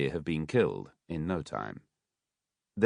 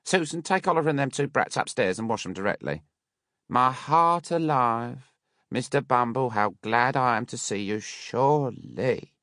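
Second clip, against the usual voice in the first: gushing Mrs Mann; 6 semitones higher than usual. A narrator's reading. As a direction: lay the welcome on thick.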